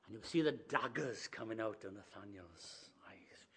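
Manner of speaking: breathy stage whisper, no voice. Real, not acted. Preacher whispering to the congregation about the angry boy. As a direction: hush it like a secret aside. Whispered aside in youth camp story.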